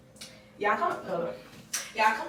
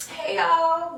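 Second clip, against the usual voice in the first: higher, drawn-out put-on hello; YouTube greeting voice; 6 semitones higher, 4 dB louder than usual. Vlogger retrying her intro, fake YouTube voice. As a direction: greet your followers in a fake high voice. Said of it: "it's not YouTube".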